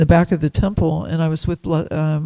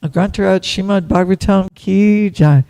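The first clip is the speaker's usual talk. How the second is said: loud closing call; 2 semitones higher, 7 dB louder than usual. Real, not acted. Teacher ending class with a loud ki jai. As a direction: call it out for the room to answer.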